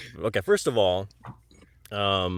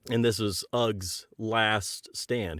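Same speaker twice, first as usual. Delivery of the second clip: dramatic slow; much slower than usual. Acted. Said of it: "dramatic storyteller beat".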